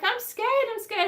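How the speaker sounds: high, mock-frightened squeal; mock scared young man. Young man to the queen, mock scared. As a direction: play a panicky young man, hammed-up. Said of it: "He's like".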